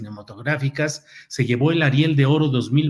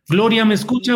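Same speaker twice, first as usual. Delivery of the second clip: calling out; 8 semitones higher, 5 dB louder than usual. Real, not acted. Calling out to a bad phone line.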